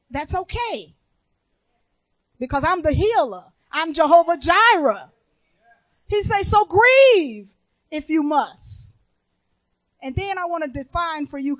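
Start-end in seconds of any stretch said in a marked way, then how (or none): 0.1-9.0 loud, rising proclamation in God's words; preaching God's answer. Acted. Preacher giving God's answer to the grieving, proclaiming loudly. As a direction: declare it big, drawing out the key word.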